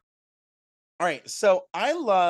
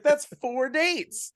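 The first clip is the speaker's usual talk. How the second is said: high, drawn-out mock objection; mock protest; 10 semitones higher, much slower than usual. Quoting a film heroine haggling over dates, mock protest. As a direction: pitch it up and stretch it out.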